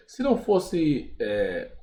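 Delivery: ordinary talk, nothing marked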